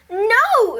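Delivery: high, loud refusal; loud exasperated shout. A narrator's reading. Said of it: "shouted Maggie".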